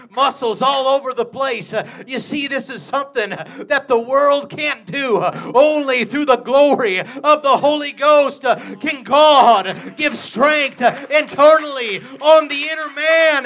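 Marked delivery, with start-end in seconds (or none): 2.8-13.4 loud, higher preacher's cry, laughing through it; preaching at full force. Acted. Pastor lifting the congregation, full-force preaching. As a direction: build it up and proclaim.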